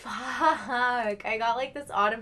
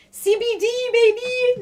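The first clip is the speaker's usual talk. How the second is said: high, loud hype shout; excited cheer; 11 semitones higher, 8 dB louder than usual. Real, not acted. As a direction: sell it like a hype man. Cheering his choice.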